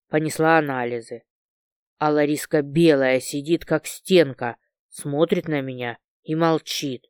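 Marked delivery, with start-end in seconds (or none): none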